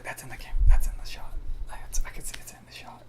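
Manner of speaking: hushed, voiceless whisper; murmured whisper, kept to oneself; retold whispered aside. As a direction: whisper it under the breath. Retold aside during the filming.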